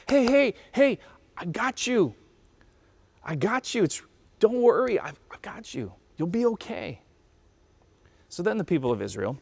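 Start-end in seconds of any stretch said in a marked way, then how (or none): 0.1-7.0 high, hushed-edged soothing reassurance; God. Acted. God comforts people caught in pain, tender reassurance. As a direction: coax gently, dropping briefly into a whisper. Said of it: "And God's like".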